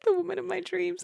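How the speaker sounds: swooning lover. Retold or imitated. Friend mocking the narrator's old adoration with a high swoon. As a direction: gush it like a lovesick fool.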